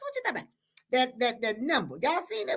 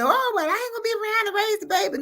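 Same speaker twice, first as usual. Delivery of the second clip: higher, fast whiny mimicry of doubters; mocking doubters; 9 semitones higher, faster than usual. Host mocking people who doubt late babies, higher, fast and whiny. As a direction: mimic the naysayers with a whine. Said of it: "I know people say".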